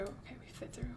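hushed, unvoiced whisper. Real, not acted. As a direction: whisper it so no one hears. Repeats line, second whispered.

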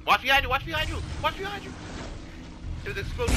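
high, urgent yelled warning; urgent warning shout. Real, not acted. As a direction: yell a warning to your teammate. Warning teammate in fight.

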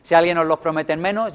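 usual speaking voice

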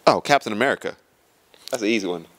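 ordinary talk

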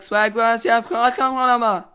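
loud, high hype chant; shouting hype; hyped shout. Real, not acted. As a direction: pump up the crowd, yelling come on. Hyping up, shouting come on.

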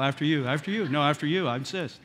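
lightly drawled Southern courtesy, overly polite; a hint of twangy Southern charm, insisting; mock Southern politeness. Southerners at the door, a light mock Southern twang. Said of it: "It's all that twangy Southern charm".

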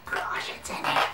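hushed, whispered thrill; excited whisper. Real, not acted. Vlogger freaking out as she opens the package, whispering.

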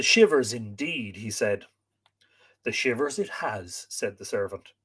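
lively, dramatic read-aloud; expressive storyteller with acted dialogue; story narration. A narrator's reading. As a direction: read the tale with wide swings of pitch.

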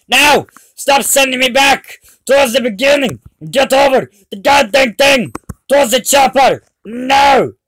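screaming in frustration at the game; furious yelling, losing it; berserk rage. Real, not acted. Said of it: "Kinda went Terminator".

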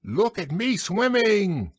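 high, drawn-out little squeal; squeaking Roo. A narrator's reading. Roo shows off from the pool, squeaking high. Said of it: "squeaked Roo".

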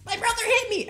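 high whiny tattletale voice; whiny tattling sibling. Retold or imitated. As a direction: whine it like a kid telling on a brother. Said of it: "it's like".

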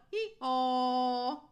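loud, long hee-haw; drawn-out donkey call; donkey bray. A narrator's reading. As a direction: bray it out at full volume.

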